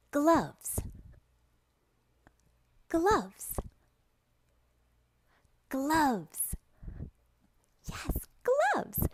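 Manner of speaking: high, singled-out word for repeating; bright-pitched drill with long pauses; word modelling. Shown as a demonstration. Modelling word for young student.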